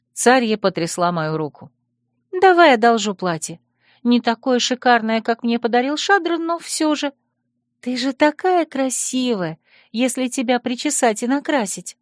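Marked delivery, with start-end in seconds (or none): 2.3-12.0 put-on high, coaxing girl voice; chirpy girl. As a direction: coax her friend sweetly. Tsaria's voice again.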